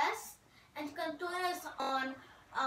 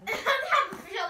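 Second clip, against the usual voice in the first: laughing; 9 semitones higher, 8 dB louder than usual. Real, not acted. Mocking friend's line.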